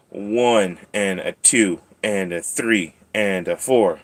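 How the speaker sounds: slow, measured counting; singsong count. Pretend. Counting nail clips for the cat, slow singsong. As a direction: count out each beat with falling pitch.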